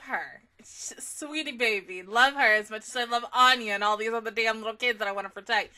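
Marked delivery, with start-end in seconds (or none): none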